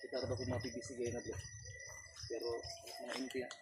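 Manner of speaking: hushed whisper while sneaking; whisper, staying unseen; whispering while hiding. Real, not acted. Sneaking quietly in forest.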